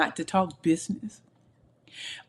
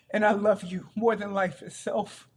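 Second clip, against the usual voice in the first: high, tearful voice full of love; crying; 6 semitones higher than usual. Real, not acted. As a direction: voice rising as real tears come. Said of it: "Sorry, I'm crying".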